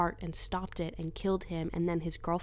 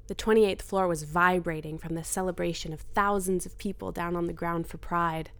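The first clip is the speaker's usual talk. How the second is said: usual speaking voice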